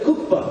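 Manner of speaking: Kukpa said high and careful, KUK-pa; repeating Kukpa. Shown as a demonstration. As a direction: echo Kukpa like a pupil copying. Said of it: "Say Kukpa".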